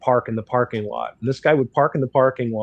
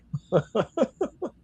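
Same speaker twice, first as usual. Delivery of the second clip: high laugh; 10 semitones higher, 3 dB quieter than usual. Real, not acted. Laughs at own remark.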